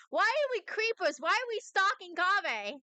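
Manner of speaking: high, loud comic outcry; exasperated protest. Real, not acted. Player told to stalk Kaveh, loudly exasperated. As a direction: wail the questions in comic disbelief.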